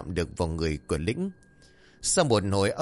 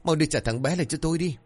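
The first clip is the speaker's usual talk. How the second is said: high wailing cry for her lost son; mother crying out for her missing baby, wailing high; 7 semitones higher, faster than usual. Acted. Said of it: "Bà liền bật khóc".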